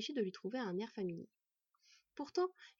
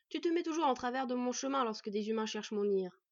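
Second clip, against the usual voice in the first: louder, annoyed reproach; irritated; 3 semitones higher, 5 dB louder than usual. A narrator's reading. Elf reproaches the she-wolf for interfering, irritated. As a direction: scold with some irritation. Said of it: "s'irrita l'elfe".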